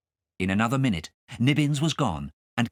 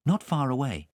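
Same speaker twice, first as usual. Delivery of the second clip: ordinary delivery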